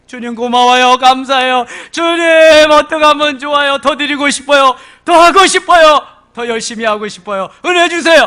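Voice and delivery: high, loud gushing gratitude; eager thanks. Acted. Preacher voicing a believer gushing thanks to God, eager. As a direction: pour out thanks to the Lord.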